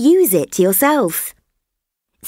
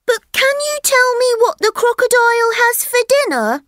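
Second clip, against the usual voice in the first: put-on much higher, fast pleading child voice; eager elephant child; much faster than usual. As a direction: rush the question out, eager. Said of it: "said the elephant's child".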